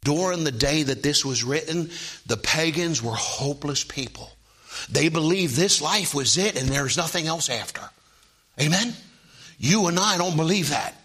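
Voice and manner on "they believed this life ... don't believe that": ordinary delivery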